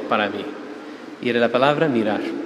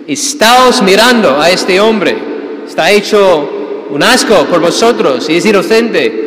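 much louder and higher, urging the crowd to look; impassioned proclamation; 6 semitones higher, 10 dB louder than usual. Retold or imitated. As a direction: cry it out to make them truly see. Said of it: "No como para decir que de paso". (proclamación apasionada, mucho más fuerte y aguda)

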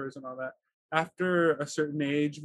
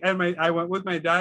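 normal talking voice

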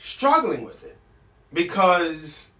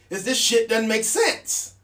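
normal talking voice

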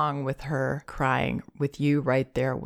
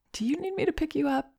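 much higher, fast, soft asking; high question to baby; 12 semitones higher, 3 dB quieter, faster than usual. Shown as a demonstration. Parent sitting up, offering to lift the crying baby, high and soft. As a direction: offer it with hands out. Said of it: "and I'd say".

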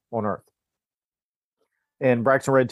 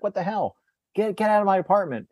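angry mother; 7 semitones higher than usual. Retold or imitated. Mother yelling at strangers.

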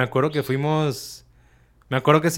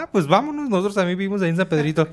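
voz de la pareja imitada; 6 semitones higher, faster than usual. Retold or imitated. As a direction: quote said much higher and faster, excited. Pareja ofrece llevarlos, voz actuada. (la pareja les ofrece aventón, voz aguda y alegre)

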